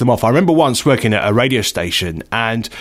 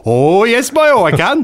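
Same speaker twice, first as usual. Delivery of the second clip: high, lilting mock-Welsh accent; hammed-up Welsh accent of a willing amateur; bad Welsh accent; 9 semitones higher, 3 dB louder than usual. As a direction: overdo a terrible Welsh singsong. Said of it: "do it really badly".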